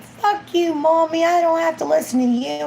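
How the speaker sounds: put-on a child swearing at mommy; defiant kid. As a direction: voice the mouthy little boy. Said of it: "if that little boy's like".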